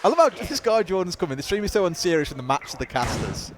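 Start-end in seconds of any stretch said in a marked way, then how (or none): none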